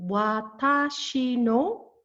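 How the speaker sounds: slow, drawn-out "Watashi no" for learners; broken down. Shown as a demonstration. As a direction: separate each syllable of "Watashi no". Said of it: "Let me break this down for you".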